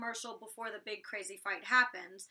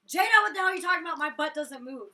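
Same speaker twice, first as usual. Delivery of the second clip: loud, raised retort easing off; indignant outburst; 4 semitones higher, 9 dB louder, faster than usual. Retold or imitated. Housemate confronting Jada about her butt, indignant outburst. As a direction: burst out loud, then settle.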